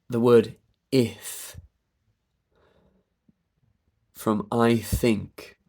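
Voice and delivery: very slow, stretched words. Shown as a demonstration. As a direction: drag each sound out to hear the join. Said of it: "If I do it really slowly".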